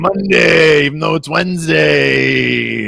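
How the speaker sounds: high, loud race caller, stretched out slowly; race announcer. Acted. Streamer kicks off the duck race in a booming announcer call. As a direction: call the start like a stadium announcer.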